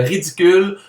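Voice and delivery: normal talking voice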